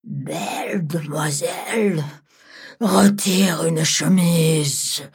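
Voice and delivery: drawn-out, menacing dragon; slow dragon voice. Acted. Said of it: "et lui dit".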